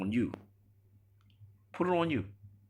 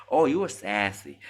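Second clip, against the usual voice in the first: a touch louder, higher, mocking a dismissive woman; mock sassy woman; 4 semitones higher, 7 dB louder than usual. Mocking women who brush off a fair question, mock sassy voice.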